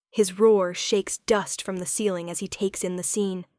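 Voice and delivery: ordinary delivery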